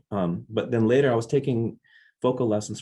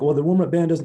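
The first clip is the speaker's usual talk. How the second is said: mock stern stage manager, louder and higher; irritated stage manager; 8 semitones higher, 7 dB louder, faster than usual. Stage manager scolding the opening band's drummer, irritated. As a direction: brush off the kid with annoyance. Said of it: "And he's like".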